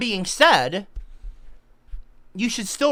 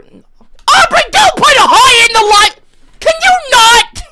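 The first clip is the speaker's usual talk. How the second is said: much higher, very loud wailing plea; whiny pleading shout; 11 dB louder than usual. Real, not acted. Husband pleads with wife in chat, whiny wail. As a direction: beg her to stop, half crying.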